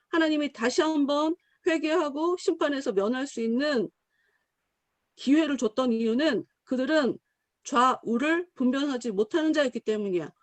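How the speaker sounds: normal talking voice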